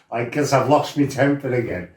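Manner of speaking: normal talking voice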